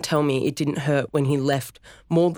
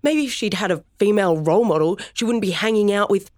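higher, louder, rising anger; heated accusation; 7 semitones higher, 3 dB louder than usual. Acted. Son accusing his father of abandoning his sister, angry and high. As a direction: hurl the blame at him.

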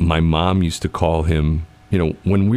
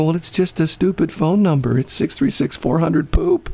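raised pitch, whiny excuse; mock defensive; 11 semitones higher than usual. Host replaying his lame excuse to co-host, whiny. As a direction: shrug it off in a high whine. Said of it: "I go".

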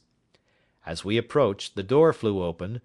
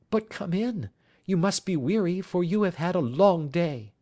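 high, slow anxious woman's voice; worried wife greeting at the door; 10 semitones higher than usual. Acted. Wife character in story.

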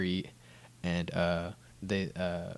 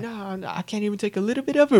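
high, loud, rapid mock pleading; hammed-up desperate objection; pleading protest; 13 semitones higher, 8 dB louder, faster than usual. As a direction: beg frantically in a put-on high voice. Character pleads to keep some money.

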